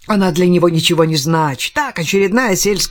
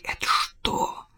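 growled; 7 semitones higher, 7 dB quieter, much slower than usual. Acted. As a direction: snarl the question quietly. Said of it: "прорычал он".

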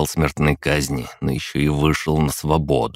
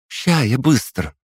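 put-on eager girl voice; 9 semitones higher than usual. Настя eager reply.

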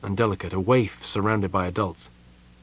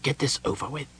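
hushed, higher urgent aside; tense confidential urging; urgent whisper; 5 semitones higher, 6 dB quieter, faster than usual. A narrator's reading. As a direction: press him under your breath. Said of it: "whispered urgently into Connell's left ear".

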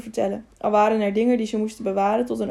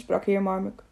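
ordinary delivery

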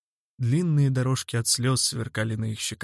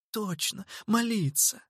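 put-on eager girl voice; 8 semitones higher, 4 dB quieter than usual. A narrator's reading. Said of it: "согласилась Зерлина".

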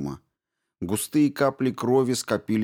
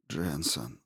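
weak murmur; 5 semitones lower, 9 dB quieter than usual. A narrator's reading. Waking Stevens weakly names her.

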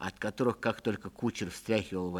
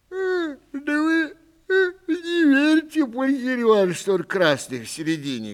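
put-on whiny old man; 7 dB louder than usual. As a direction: whine like a doddering old gent. Old man about watermelon.

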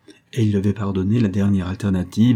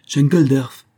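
higher, louder sharp call; crying out; 6 semitones higher, 7 dB louder, slower than usual. A narrator's reading. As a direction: call out the name to cut someone off. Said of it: "s'écria-t-elle". (cri d'interpellation)

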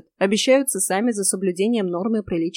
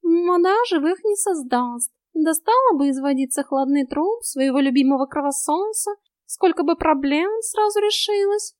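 grumbling cat; 8 semitones higher, 3 dB louder than usual. A narrator's reading. Cat muses on the bloodsucker's corpse, high drawling grumble.

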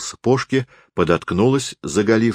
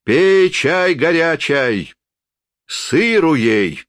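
put-on high, slow drunken hospitality; a drunk host, pitched high and slow; tipsy forester; 10 semitones higher, 4 dB louder, much slower than usual. A narrator's reading. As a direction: press tea on the lady.

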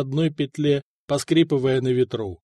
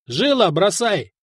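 slow, raised-pitch command; called-out order; 4 semitones higher, slower than usual. A narrator's reading. Commander telling his man to throw the purse, calling out. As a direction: call the order to your man.

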